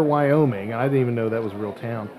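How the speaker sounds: usual speaking voice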